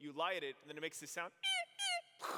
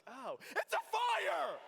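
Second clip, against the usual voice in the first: high, loud, slow alarmed cry; shocked shout; 13 semitones higher, 7 dB louder, slower than usual. Retold or imitated. Boy spotting the front yard burning, shouting in shock. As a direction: yell it as the lawn catches fire. Discovering the lawn on fire.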